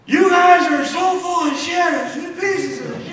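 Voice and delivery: high, loud furious rant, slow and heavy; screaming manager. Retold or imitated. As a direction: scream it down at a losing team. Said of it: "he's screaming up and down".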